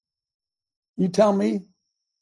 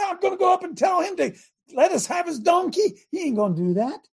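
incredulous disciple; 11 semitones higher than usual. Acted. Disciple protesting Jesus's donkey request, high and incredulous. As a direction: protest like he's lost his mind.

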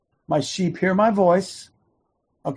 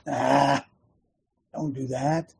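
tempting other voice; 4 semitones lower, 7 dB quieter, slower than usual. Acted. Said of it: "another voice comes".

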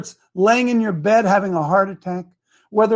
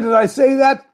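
ordinary delivery

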